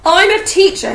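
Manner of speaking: high, loud angry roar. A narrator's reading. Hagrid roaring at Harry at the door. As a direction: bellow in outrage. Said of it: "he roared at Harry".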